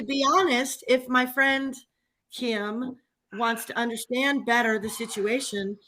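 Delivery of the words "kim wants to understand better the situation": ordinary talk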